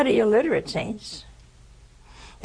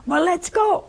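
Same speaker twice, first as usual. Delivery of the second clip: high-pitched, eager quote; excited imitation; retold excitement; 10 semitones higher than usual. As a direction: voice leaps up, raring to go. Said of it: "he was so excited".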